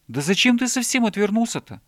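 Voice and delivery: high, loud, indignant question; exasperated protest. A narrator's reading. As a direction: protest as if baffled by a friend. Hedgehog protests bear turned away.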